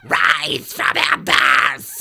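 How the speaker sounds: slow, raspy cartoon villain voice; hammy villain proclamation in a harsh voice; Skeletor. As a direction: rasp it out, stretching every word. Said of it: "more Skeletor-like".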